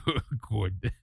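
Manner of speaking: low mock reviewer voice; fed-up YouTuber imitation. Streamer repeating the reviewer's word, low fed-up imitation. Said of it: "he talks as though he's fed up with himself".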